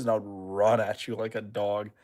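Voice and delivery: usual speaking voice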